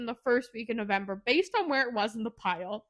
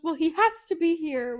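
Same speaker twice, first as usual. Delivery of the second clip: louder, higher acted insistence; mock insistence; 5 semitones higher, 5 dB louder than usual. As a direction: insist loudly, as in a retold argument.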